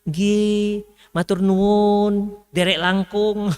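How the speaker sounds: slow, gentle Javanese politeness; drawn-out refined Javanese courtesy; lightly voiced soft Javanese speaker. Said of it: "Kalau yang Jogja halus".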